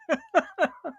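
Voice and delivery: high, short burst of laughter; amused laugh. Real, not acted. Chatting about a viewer's channel name, amused laugh.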